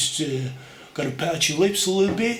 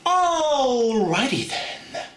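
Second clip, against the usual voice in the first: much higher, very slow goofy drawl; Jim Carrey; 7 semitones higher, much slower than usual. Retold or imitated. Jim Carrey catchphrase, high and drawn out. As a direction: stretch it out like a zany comic. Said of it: "I can do Jim Carrey".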